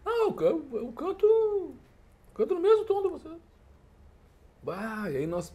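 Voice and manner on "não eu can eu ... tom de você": put-on cocky kid voice. Boy tells the band he'll sing in their key, high cocky kid voice. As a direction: play the boastful boy, pitched way up.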